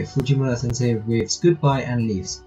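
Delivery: ordinary delivery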